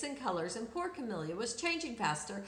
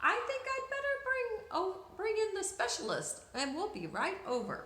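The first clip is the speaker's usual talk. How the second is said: flustered doctor; 7 semitones higher, faster than usual. A narrator's reading.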